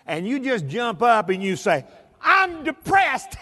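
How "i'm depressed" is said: high, drawn-out mock-gleeful cry; happy, enthusiastic. Shown as a demonstration. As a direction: jump up and belt it out with comic joy. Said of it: "act happy and enthusiastic".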